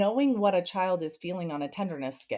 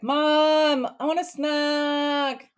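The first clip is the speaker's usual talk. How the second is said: high, slow, louder whine; whiny kid; 7 semitones higher, 6 dB louder, much slower than usual. Retold or imitated. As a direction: drag it out like a demanding child. Son demanding snack.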